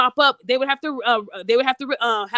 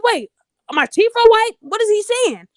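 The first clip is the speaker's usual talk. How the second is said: higher and louder baffled inner voice; confused surprise; 8 semitones higher, 4 dB louder than usual. Retold or imitated. She recalls being baffled by the insult, higher and louder. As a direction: puzzle it out, pitch up. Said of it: "So I'm thinking".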